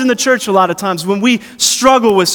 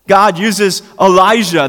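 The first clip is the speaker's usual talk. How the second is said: ordinary talk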